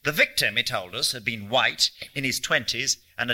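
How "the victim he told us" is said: plain reading voice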